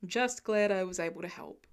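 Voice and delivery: lightly voiced lieutenant; modest older man. A narrator's reading. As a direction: shrug it off kindly. Lieutenant answers kindly.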